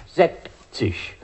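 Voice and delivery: „sechzig“ mit weichem sch am Ende, wie Sächsisch; „sechzig“ zu „säckzisch“ verschliffen. Shown as a demonstration. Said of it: "Beim Wort Zahlwort Sächsisch ist das so auszusprechen". („sechzig“ ausgesprochen wie „Sächsisch“)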